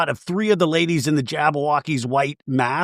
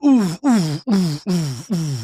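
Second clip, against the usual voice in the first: mock poisoned moaning; 2 semitones higher, slower than usual. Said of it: "falling down, like they got poisoned".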